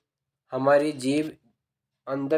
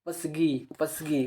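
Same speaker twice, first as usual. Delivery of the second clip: soft careful repeat; 5 dB quieter, slower than usual. Shown as a demonstration. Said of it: "देखो इसको उच्चारण को पकड़ो".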